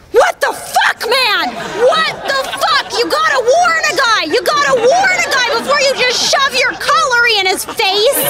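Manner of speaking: high-pitched indignant outburst; outraged comic friend. Retold or imitated. Comic friend complaining about the hook handshake, high indignant voice. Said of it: "he goes".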